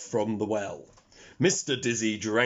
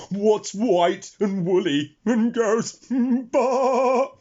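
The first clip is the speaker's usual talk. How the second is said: high, slow teasing question; pig holding back laughter; 8 semitones higher, 5 dB louder, slower than usual. A narrator's reading. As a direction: keep a straight face while teasing. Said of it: "trying to keep a straight face".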